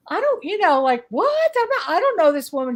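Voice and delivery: high, puzzled disbelief; retelling her own bewildered reply; baffled protest. Retold or imitated. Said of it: "And I'm like".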